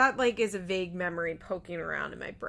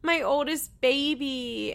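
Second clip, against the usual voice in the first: slow, higher syrupy mock-sweet voice; mocking Ashley; 5 semitones higher, 3 dB louder, slower than usual. Mocking the girlfriend's post, slow and cooing. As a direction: coo it out sarcastically like a doting girlfriend. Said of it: "and is like".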